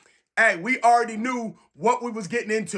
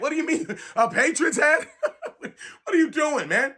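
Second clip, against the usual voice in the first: higher, laughing in disbelief; 5 semitones higher than usual. Retold or imitated. Mocking a coworker in a Patriots hat, laughing disbelief. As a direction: laugh it off, can't believe the answer. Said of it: "I'm like".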